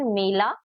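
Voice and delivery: "मेला" with a closer ay sound; ए vowel. Shown as a demonstration. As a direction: keep the first vowel of "मेला" as ay. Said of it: "pronounce करने का तरीका थोड़ा different".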